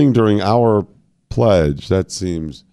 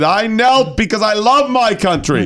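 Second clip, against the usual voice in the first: high, slow preachy voice; 10 semitones higher than usual. Pretend. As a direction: preach it from the pulpit. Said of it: "Get a preachy voice".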